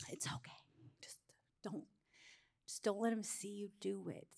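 hushed, halting secret whisper; conspiratorial whisper. Retold or imitated. Mom secretly telling her daughter not to let dad see, whispered. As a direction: whisper it like a secret kept from someone.

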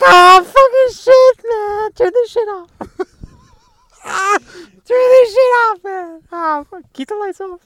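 high, slow hammed-up weeping voice; overdone weepy plea to turn the film off; mock sobbing. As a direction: pretend you're falling apart crying. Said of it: "And I'm like".

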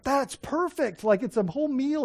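high-pitched retold enthusiasm; delighted. Preacher hearing the idea of sharing with the homeless, delighted. Retells his delighted reply.

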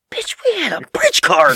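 slow, loud, high indignant shout; mock angry yell. Son shouting at mom about the bridge card. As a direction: spit it out in mock outrage.

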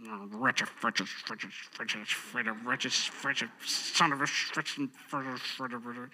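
put-on a kid grumbling under his breath; grumbling child. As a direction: mutter it like a sulking kid. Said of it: "What is grumbling?".

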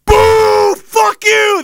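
high, loud angry yell; booing crowd. Retold or imitated. Fans booing the commissioner, loud yell. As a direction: boo him like an outraged arena. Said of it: "The whole place was just like".

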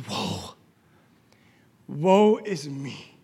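low, hushed and slow; overwhelmed. Acted. Isaiah sees the holy vision, stunned and hushed. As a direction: let it out softly, stunned. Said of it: "his mind has been rocked".

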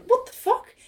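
high-pitched baffled disbelief; baffled, pitch leaping up; acted-out bewilderment; incredulous. Reacting to an absurd scene.

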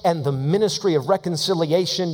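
ordinary talk

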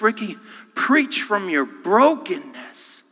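slow, emphatic coaching; earnest push to preach; urging Ricky. Retold or imitated. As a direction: lean into each word like a mentor. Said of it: "I go like this".

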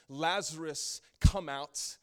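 a booming call to the dead; loud command. Retold or imitated. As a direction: call him out of the grave, loud and ringing. God's loud call at tomb.

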